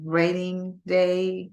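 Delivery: ordinary talk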